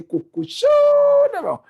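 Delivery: high, stretched-out show cry; drawn-out hype call. Acted. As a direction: belt out the nickname, holding the last vowel. Calls out the show's nickname.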